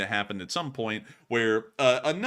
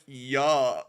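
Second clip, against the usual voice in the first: imitated disgust. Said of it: "he went".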